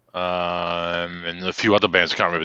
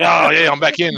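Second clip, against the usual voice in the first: loud, higher cheer of victory; triumphant shout; 6 semitones higher, 6 dB louder than usual. Retold or imitated. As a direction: crow it out, you got back in. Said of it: "I was like".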